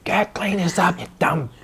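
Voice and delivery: hissed, whispery mock order; mock-nasty command, drawn out into a harsh whisper; imitating partner off camera. Imitating a partner's off-camera orders, mock-mean whisper. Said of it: "she'll be like".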